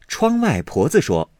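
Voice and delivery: plain reading voice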